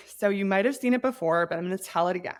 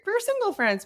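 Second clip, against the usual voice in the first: usual speaking voice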